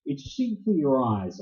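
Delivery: ordinary talk, nothing marked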